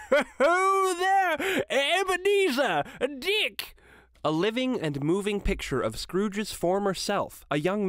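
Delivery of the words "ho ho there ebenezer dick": put-on a fat, jovial old master calling, far higher and louder; hearty ho-ho call of a jolly boss. A narrator's reading. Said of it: "called out in a comfortable, oily, rich, fat, jovial voice".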